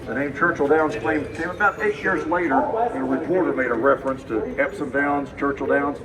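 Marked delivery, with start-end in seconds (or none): none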